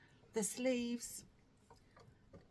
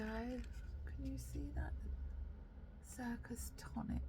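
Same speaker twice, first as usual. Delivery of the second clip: 1 whisper; 3 semitones higher, 6 dB quieter, slower than usual. Real, not acted. Whispering while showing yarn band.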